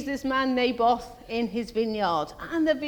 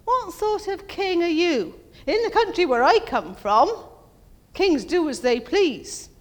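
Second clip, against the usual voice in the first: higher, louder, slow scolding taunt; scornful Jezebel; 7 semitones higher, 4 dB louder than usual. Retold or imitated. Jezebel scolding sulking Ahab about the vineyard, slow scornful taunt. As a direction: shame him into acting like a king. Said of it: "And she says".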